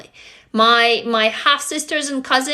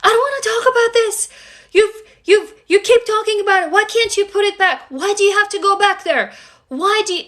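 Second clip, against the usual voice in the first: high, loud exasperated outburst; heated, high-pitched protesting; angry stepmom; 8 semitones higher, 3 dB louder than usual. Retold or imitated. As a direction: imitate a stepmom snapping under pressure. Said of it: "she got angry".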